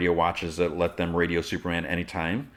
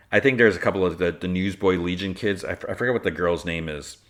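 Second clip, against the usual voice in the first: ordinary talk, nothing marked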